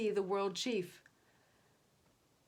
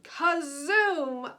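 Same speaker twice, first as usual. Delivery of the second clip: rocket blast; 7 semitones higher, 10 dB louder than usual. A narrator's reading. Ships blasting off.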